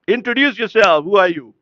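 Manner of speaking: loud, high, commanding question; voice of God. Acted. Divine voice demands Jibreel name himself, commanding. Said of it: "then the sound, the voice, the, the question comes again".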